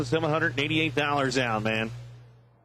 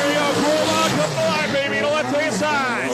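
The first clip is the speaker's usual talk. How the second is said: loud, high, pumped-up play-by-play; race announcer hype; 10 semitones higher, 4 dB louder than usual. Real, not acted. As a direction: call the race like the crowd's on fire. Live drag race commentary.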